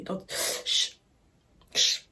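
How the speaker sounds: soft, whispered shushing; hushing. Retold or imitated. Storyteller retells silencing her date in the movie, whispered hush. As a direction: cut off with a breathy shh.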